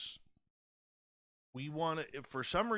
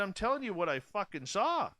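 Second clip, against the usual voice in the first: higher, forceful swearing; angry insistence; 6 semitones higher, 4 dB louder than usual. Retold or imitated. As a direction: insist hard, voice up. Retold heated argument with dad.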